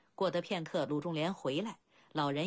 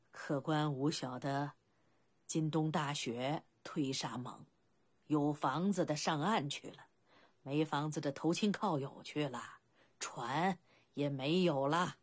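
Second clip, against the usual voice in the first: put-on low, unhurried elderly villager; amused old boatman; 4 semitones lower than usual. Old boatman's dialect dialogue. (低缓的老船夫乡音，略带笑意)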